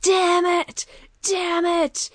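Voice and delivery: high-pitched mock yell, repeated; Psycho Sid yelling. Wrestler cursing at the camera, imitated yell. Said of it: "he's yelling at the camera".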